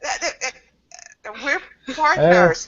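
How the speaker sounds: high, giggling stammer of denial; flustered laughing denial. Acted. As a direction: laugh it off, caught off guard.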